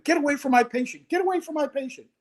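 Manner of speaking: high-pitched mock scream; retold screaming. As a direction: imitate a frantic medic shrieking orders, without full volume. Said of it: "she's screaming".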